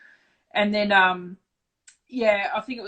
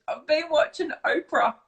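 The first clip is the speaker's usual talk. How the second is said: imitating teary mum; 8 semitones higher than usual. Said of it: "blubbering like this".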